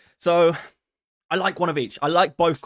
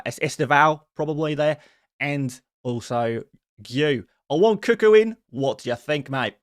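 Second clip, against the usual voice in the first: reading a fan's message in a hammed-up Australian accent; slower than usual. Reading Tio's super chat aloud to chat, in an Australian accent. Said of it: "an Australian accent".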